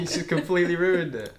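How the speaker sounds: normal talking voice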